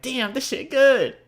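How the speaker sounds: high, loud, drawn-out rave; delighted diner's exclamation. Acted. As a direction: exclaim it like the food floors you. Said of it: "you're gonna be like".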